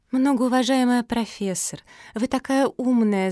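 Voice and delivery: high, pleading flattery; wheedling whine. Acted. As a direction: whine sweetly to get your way. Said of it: "законючила Антонина".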